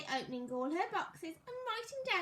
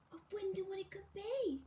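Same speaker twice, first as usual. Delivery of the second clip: soft eager whisper to herself; 2 semitones higher, 3 dB quieter than usual. Acted. Miss Tidy whispering, curious about her birthday present. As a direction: breathe it out, curious about the gift.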